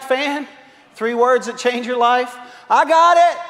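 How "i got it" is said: high, loud call of I got it; a fan's doomed shout; confident call. Retold or imitated. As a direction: yell it like a sure catch. Razorback player calling for ball.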